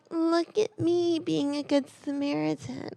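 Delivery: high, soft, self-satisfied mimicry; mock a do-gooder in a small high voice; put-on girly voice for a smug driver; mocking girlish voice. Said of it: "now he's a girl".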